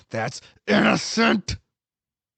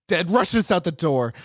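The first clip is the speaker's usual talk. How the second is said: plain reading voice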